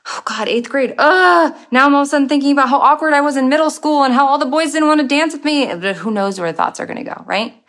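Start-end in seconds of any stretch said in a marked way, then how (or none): 0.1-5.7 higher, louder, fast rambling panic; anxious spiral. Pretend. Unaware mind spiralling into school memories, in a fast anxious voice. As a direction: let the worry run away with you.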